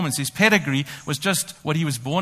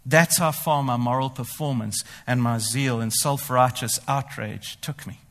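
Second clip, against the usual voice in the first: ordinary talk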